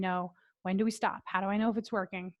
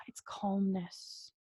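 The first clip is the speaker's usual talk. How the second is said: slow whispered sigh; hushed and unhurried; calm whisper; 2 semitones lower, 8 dB quieter, much slower than usual. Shown as a demonstration. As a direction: exhale the words. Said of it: "it's a deep breath".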